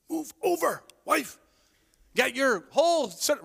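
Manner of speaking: a touch of a pushy husband hogging the bed; gruff selfish demand. Retold or imitated. To his sleeping wife, mildly bossy. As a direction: bark it like a mild mock grump.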